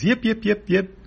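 high-pitched rhythmic yips; cartoon animal yapping; yipping creature. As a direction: yelp like a little dog on the run. Ruddock yips while chased.

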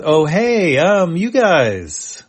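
slow, high dopey voice drawn out; dopey drawl. Acted. Dopey Chipu greets the humans in a slow high drawl. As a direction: play it dazed and sleepy-happy. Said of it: "Chipu, all dopey".

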